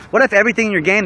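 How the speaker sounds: ordinary talk, nothing marked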